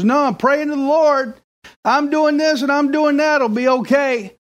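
stubborn man, voice pitched high; high stubborn refusal. Retold or imitated. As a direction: insist you're fine, high.

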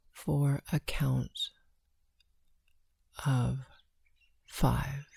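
slow, hushed breath counting. Acted.

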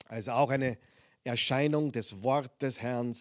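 usual speaking voice

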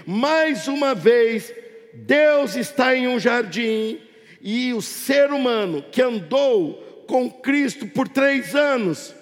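projected sermon with stretched key phrases; impassioned pulpit proclamation; fervent preaching. Real, not acted. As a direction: drive each phrase out to the congregation, drawing out the stresses. Preaches to congregation at full force.

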